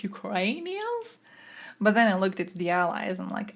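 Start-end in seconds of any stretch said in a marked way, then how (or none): 0.0-1.2 high, slow puzzled question; confused surprise. Retold or imitated. Reacting to a mistranslation with high puzzled disbelief. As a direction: stretch it out in disbelief. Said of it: "and I was like".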